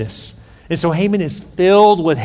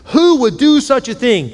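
high, indignant royal question; demanding; 6 semitones higher than usual. A narrator's reading. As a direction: demand an answer, pitch raised. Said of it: "King Xerxes demanded".

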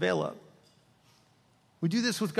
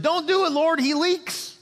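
high, loud, slowed punchline protest; exasperated old lady; 11 semitones higher, 4 dB louder, slower than usual. Retold or imitated. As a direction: cry out in mock alarm from the front row. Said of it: "she jumps up and said".

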